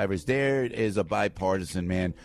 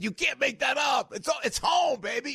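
excited boast; 14 semitones higher than usual. Real, not acted. Proud Jersey boast.

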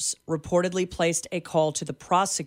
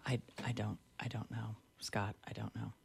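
barely audible whisper, much softer; 5 semitones lower, 16 dB quieter, faster than usual. Real, not acted. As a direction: drop to a breathless whisper of disbelief. Stunned by the hoax question.

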